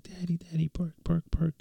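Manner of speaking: put-on high whispery little-girl pleading; imitating little daughter. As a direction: beg like an eager toddler. Daughter begging for park.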